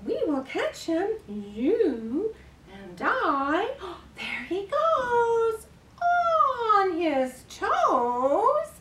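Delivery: slow sing-song with excited gasps; nursery rhyme performance. Pretend. Carer chasing a fly up to baby's nose, playful sing-song. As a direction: draw it out, building to playful tickles.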